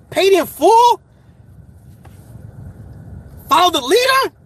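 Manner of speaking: high, loud excited album shout-outs; hyped up. Real, not acted. Vlogger to viewers naming [name] albums, excited shout. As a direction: call out the classics like a fan. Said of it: "I'm getting too hyped".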